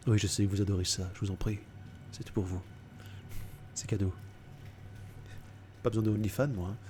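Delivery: low, soft suave seduction; sultry narrator. Acted. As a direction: purr it like a smooth charmer. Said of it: "lui faire une voix, hmm, suave".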